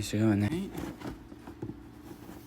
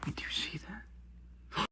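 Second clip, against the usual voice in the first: breathless whisper at the cabinet; 5 semitones higher, 16 dB quieter, slower than usual. Real, not acted. Vlogger peeking into the cabinet at a hidden animal, whispering. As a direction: hush it so the creature won't hear.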